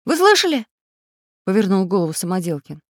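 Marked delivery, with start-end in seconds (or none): 0.1-0.7 high, loud, startled question; alert question. A narrator's reading. Самоделкин asking friends about a cry, startled. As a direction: ask it urgently, pitched up.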